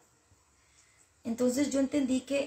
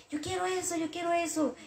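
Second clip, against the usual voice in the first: put-on higher, whining little child; whiny child; 6 semitones higher than usual. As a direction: whine like a kid who wants a toy. Crying child demanding a toy.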